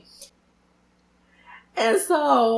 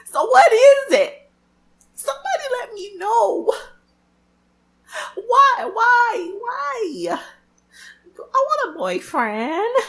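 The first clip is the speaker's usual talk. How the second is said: much higher, pleading why, why, why; whiny plea; 13 semitones higher, 4 dB louder, slower than usual. Real, not acted. Asking her viewers why she's single, high and whiny. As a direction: beg the universe for an answer.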